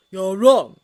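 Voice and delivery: loud cold insistence; firm denial. A narrator's reading. Holmes insisting to Snape, loud and hard. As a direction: shut him down.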